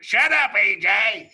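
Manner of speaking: high, loud barked shut up; hammed-up inner critic shouting; mock inner voice yell. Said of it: "what accent that voice is in".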